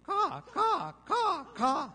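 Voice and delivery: very high, loud, short caws; piercingly high imitated gull barks; sharp seagull bark. Shown as a demonstration. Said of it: "like a sharp bark".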